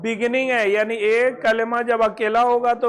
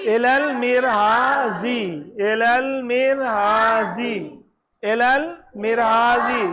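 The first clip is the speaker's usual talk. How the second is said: इलल मिरहाजी said slowly three times, ending on i; slow repeated model reading; much slower than usual. Shown as a demonstration. Teacher drills इलल मिरहाजी slowly for pupils. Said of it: "ये वाला, ये वाला".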